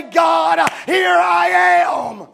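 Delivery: a loud, slow cry of God, here I am; shouted answer. Acted. Preacher to youth, voicing an eager reply, slow and shouted. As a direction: shout it out like a young man answering the call.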